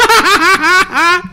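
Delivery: real laugh, loud and high; high burst of laughter. Real, not acted. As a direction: laugh out at your own memory. Laughing at his own story.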